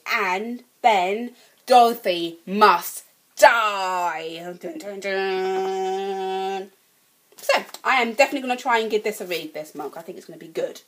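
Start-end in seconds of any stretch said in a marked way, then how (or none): 0.1-4.5 mock ominous read. Reading the ominous back blurb. 4.6-6.7 slow, low, drawn-out dun dun dun; mock dramatic sting. After reading the blurb to viewers, a slow mock-ominous dun dun dun.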